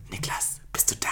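whispered, barely voiced; quiet call. A narrator's reading. Robin whispers for Niklas at the fence hole. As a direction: whisper his name into the dark. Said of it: "er rief leise".

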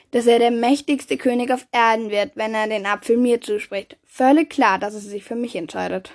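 ordinary delivery, nothing marked

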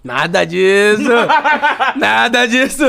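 slow, laughing "nada disso"; laughing denial. Real, not acted. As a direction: protest through real laughter. Denying while friends tease him.

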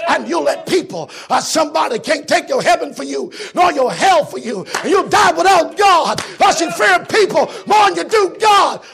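rising to loud shouted preaching; building preaching. Acted. Preacher warning the church with a rising shout. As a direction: build to a roar.

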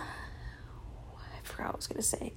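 whispered aside to herself; trailing off. Real, not acted. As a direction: drop to a breathy whisper, losing the thought. Loses train of thought mid-talk.

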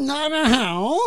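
put-on high-pitched parody Chinese accent; exaggerated high Chinese greeting; mock Chinese accent. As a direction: parrot it back in a squeaky fake Chinese accent.

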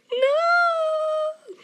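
hammed-up high, loud fake cry; mock wailing. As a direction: wail it out in comic despair. Mock wail at being roasted.

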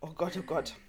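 recovering from a coughing fit; hoarse after choking; choking on a drink. Real, not acted. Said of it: "Ich hab mich verschluckt". (verschluckt, heiser nach dem Husten)

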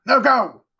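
high, loud, impatient command; barked dismissal; shouted order. A narrator's reading. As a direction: send the boy off with a sharp shout. Servant orders the boy up.

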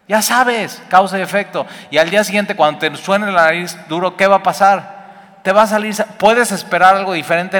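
loud, high and rising, hammering each point home; preaching at full force. Acted. Preacher to congregation, cause and effect, loud fiery preaching. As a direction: drive the lesson like a fiery preacher.

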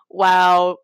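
usual speaking voice

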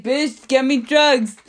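loud, high, drawn-out mock plea; exasperated demand. Sick kid demanding allergy medicine from mom, mock-exasperated and loud. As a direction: blurt it as a hammed-up demand.

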